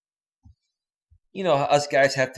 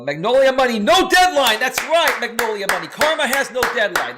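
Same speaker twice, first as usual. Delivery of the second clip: excited; 8 semitones higher, 5 dB louder than usual. Real, not acted. Excited greeting of viewer.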